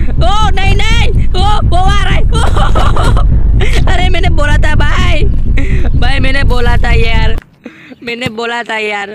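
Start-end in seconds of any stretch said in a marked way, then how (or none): 0.1-7.4 loud, high panicked shout; scared friend yelling I told you so. Real, not acted. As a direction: scream it, terrified. Panic as uncle comes.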